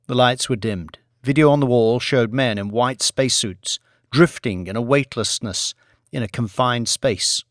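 usual speaking voice

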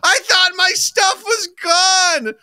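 high, loud drawn-out wail; mock upset kid yelling back; hammed-up outraged whine at full volume; imitating mad child. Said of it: "She got so mad at me".

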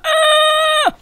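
high sudden yelp; a quick high cry of alarm; startled yelp. Real, not acted. Chicken player nearly caught, high startled yelp.

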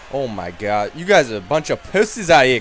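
normal talking voice

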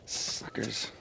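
whispered scornful aside. Acted.

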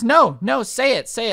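high, loud mock demand; insistent challenge. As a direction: imitate an offended customer daring someone. Customer demanding he repeat it.

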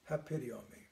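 soft, hushed repetition of a prayer; softly repeating a short prayer; gentle prayer. Shown as a demonstration. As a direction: murmur it gently, like a feather landing. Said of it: "I just gently say the words".

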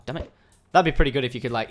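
usual speaking voice

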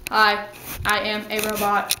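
low monotone robot. Pretend. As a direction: say it word by word like a machine. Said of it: "talk in our robot voices".

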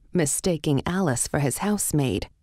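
plain reading voice

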